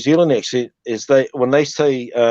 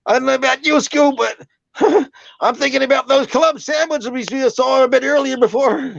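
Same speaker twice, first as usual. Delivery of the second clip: very high, goofy cartoon slacker; Shaggy impression; 12 semitones higher than usual. Comedian retrying the impression for the host, high Shaggy voice. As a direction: push it up into a high cartoon whine. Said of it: "Shaggy's a bit more like".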